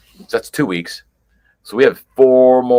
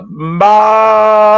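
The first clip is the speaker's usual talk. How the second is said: higher, louder, a big call of the name Bob; excited shout; 10 semitones higher, 7 dB louder than usual. Real, not acted. Host greeting viewer Bob with an excited shout. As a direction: shout his name out like a game-show host.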